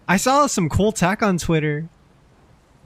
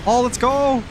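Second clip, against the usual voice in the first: excited cheer; 9 semitones higher, 3 dB louder than usual. Real, not acted. Cheering in fight.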